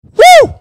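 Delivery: loud high-pitched "woo"; short, loud, high cheer; excited whoop. Real, not acted. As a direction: let out a celebratory whoop. Cheering after the winning clip.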